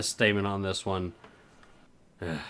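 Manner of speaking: usual speaking voice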